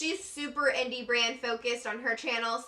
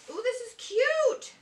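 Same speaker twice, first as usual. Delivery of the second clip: high, drawn-out coo of delight; 10 semitones higher, slower than usual. Real, not acted. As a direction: coo over the cute packaging. Unboxing cute packaging.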